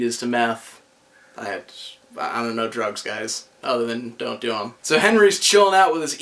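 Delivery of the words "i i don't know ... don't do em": quiet embarrassed mumble; soft, low confession; sheepish aside. Real, not acted.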